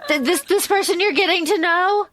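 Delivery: high-pitched disbelief at the first love; incredulous. Real, not acted. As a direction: push it up, half laughing at the idea. Continued incredulous rant.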